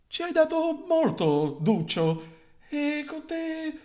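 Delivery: high, slow, theatrical mourner voice; a sugary lament that sours into insult; mourner's bitter eulogy. Acted. A voice from a church corner mocking the dead man, high and slow.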